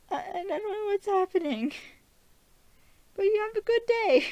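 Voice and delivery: whimpering panic with a crying edge; scared man cornered in an elevator, high and tearful; terrified Steven. Retold or imitated. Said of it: "Steven's just huddled in the corner, terrified".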